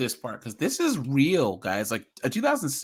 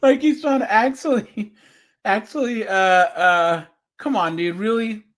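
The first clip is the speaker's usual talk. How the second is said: higher and louder, incredulous; exasperated disbelief; 6 semitones higher, 5 dB louder than usual. Real, not acted. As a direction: come on, really, can't believe this guy. Mocking a predator's lame excuse.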